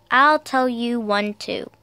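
usual speaking voice

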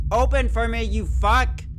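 loud, exasperated cursing; frustrated demand. Real, not acted. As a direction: order the door open, desperate to get out. Yelling at a stuck game door.

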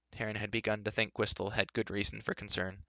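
ordinary delivery

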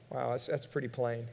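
ordinary talk, nothing marked

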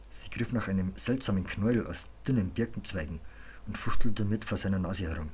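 ordinary delivery

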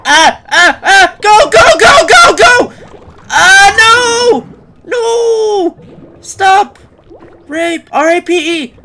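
high, loud panicked screams; frantic screaming. Pretend. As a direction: scream for dear life. Chased and attacked by creatures.

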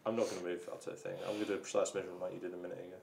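normal talking voice

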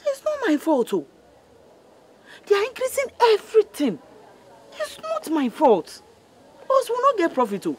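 high complaining whine; mother lamenting rising prices. Acted. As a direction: plead that it's not her fault.

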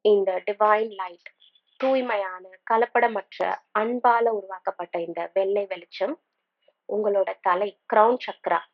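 unhurried meditation guide, long pauses between phrases; calm, spaced-out instructions with room to breathe; guided meditation. Acted. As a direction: lead them gently through breathing and imagery.

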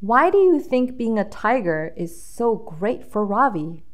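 ordinary delivery

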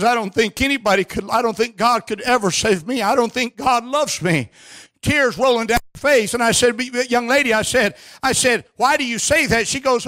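high, forceful revival sermon delivery; fervent preaching. Real, not acted. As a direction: drive it home to the congregation, drawing out key words. Preaches a story at full force.

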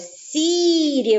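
СІРІ with a drawn-out first syllable; stress on СІ. Shown as a demonstration. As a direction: lean long on the СІ of СІРІ. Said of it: "буде падати наголос". (СІРІ з протяжним наголошеним СІ)

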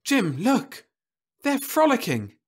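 high, excited exclamation; exclaimed. A narrator's reading. Wife suddenly calling husband to the window, excited. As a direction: point it out eagerly. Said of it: "Louise exclaimed suddenly".

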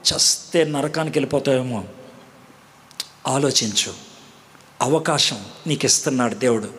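preacher's hushed invitation to pray; altar call. Acted. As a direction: draw them gently to repent.

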